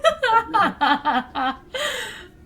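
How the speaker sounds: loud, high cackling laugh; long peal of laughter; helpless laughter. Real, not acted. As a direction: burst out laughing at a friend's joke. Reacts to co-host's joke.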